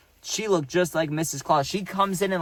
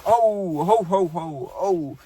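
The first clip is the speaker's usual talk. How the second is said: mock-pained "oh, ho, ho" moans; imitated moaning; 3 semitones higher, much slower than usual. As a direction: act out moaning as if it hurts. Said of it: "he's, like, moaning and stuff, like it hurts".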